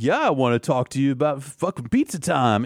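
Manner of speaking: high-pitched surfer-bro Ninja Turtle; Yamcha. Acted. Yamcha wants to talk pizza with King Kai, surfer-dude impression. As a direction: do a shitty Michelangelo impression. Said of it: "doing a shitty Michelangelo impression".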